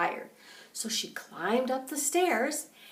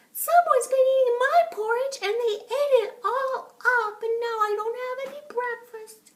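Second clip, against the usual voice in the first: very high squeaky little bear voice; tiny high whine of a baby bear; 13 semitones higher, 5 dB louder than usual. A narrator's reading. Baby bear complains his breakfast is gone, high and squeaky. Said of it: "the baby bear said".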